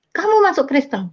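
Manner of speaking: accusing question. Retold or imitated. Sibling confronts a relative about converting, mock accusing tone. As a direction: imitate an accusing older sibling.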